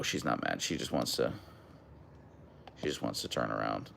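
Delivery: a soft low aside about the dog turning around; hushed aside. Real, not acted. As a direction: murmur it under your breath.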